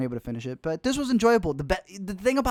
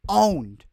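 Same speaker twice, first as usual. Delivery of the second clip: Owned said louder, higher, with a falling end; emphatic repeat; 4 semitones higher, 8 dB louder than usual. Real, not acted. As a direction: punch the word Owned hard. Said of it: "how N B A players are literally owned".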